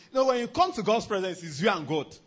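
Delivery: ordinary talk, nothing marked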